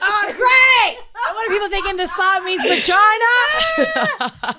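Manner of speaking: loud, slow mock outrage; loud yell. Real, not acted.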